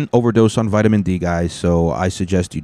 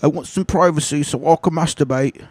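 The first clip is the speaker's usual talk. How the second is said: high-pitched put-on British boy; mock British accent; 10 semitones higher than usual. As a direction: play the kid in a silly, high British voice. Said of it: "I don't know why he talks like that".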